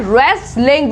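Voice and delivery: रेसलिंग with a plain R; wrestling as रेसलिंग; silent W. Shown as a demonstration. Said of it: "यहाँ पे W silent है".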